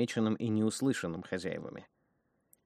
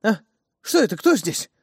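startled; 11 semitones higher, 11 dB louder than usual. Acted. Woman startled by a blade.

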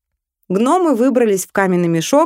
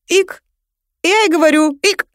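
икота; 10 semitones higher, 4 dB louder than usual. Acted. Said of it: "возвращается вылеченная икота".

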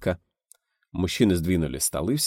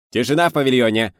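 stern shout; 5 semitones higher, 7 dB louder than usual. A narrator's reading. Sound engineer silencing the studio, stern shout. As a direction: shut the room up sternly. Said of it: "строго прикрикнула звукорежиссёр".

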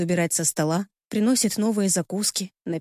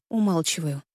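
ordinary delivery

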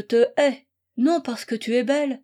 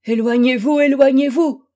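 much louder, horrified order to back away; loud, urgent, frantic command; distraught cry; 3 semitones higher, 9 dB louder than usual. A narrator's reading. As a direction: cry out in horror, driving someone off. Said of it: "lui cria-t-elle, dans un état impossible à décrire".

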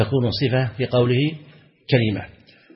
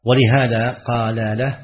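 ordinary delivery

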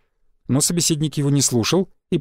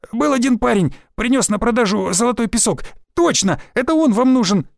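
higher, relieved whining; joyful whimper; 7 semitones higher than usual. A narrator's reading. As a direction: whimper it with desperate hope. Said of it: "радостно проскулил Измаил". (радостное поскуливание, выше)

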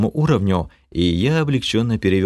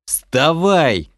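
panicked yell; 7 semitones higher than usual. A narrator's reading. Mage yells in panic at fallen tank. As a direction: scream at him to get up. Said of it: "в панике заорал я".

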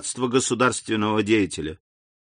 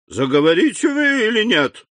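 shouting at the minister; angry shout; 9 semitones higher, 3 dB louder than usual. Acted. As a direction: demand it loudly. King demands an answer. (король кричит на министра)

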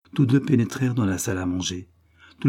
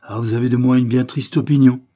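louder, offended reproach; sour reproach; 3 semitones higher, 7 dB louder than usual. A narrator's reading. Sister reproaches her brother, offended and sour. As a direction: let the hurt pride show. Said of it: "d'un ton aigre".

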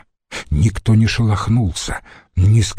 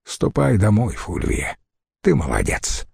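tender praise; 3 dB quieter than usual. Acted. Husband gently sends his wife home. (ласково, вполголоса)

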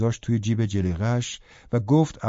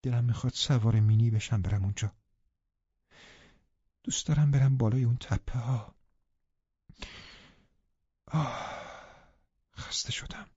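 softer, fading to a whispered sigh; under her breath; 5 dB quieter than usual. Acted. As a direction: murmur it wistfully to herself. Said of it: "زیر لب گفت". (زیر لب و آهسته)

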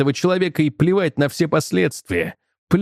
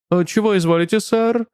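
lightly voiced waiter, raised pitch; a polite, higher-pitched service question; obliging waiter; 6 semitones higher than usual. A narrator's reading. Waiter addressing a customer.

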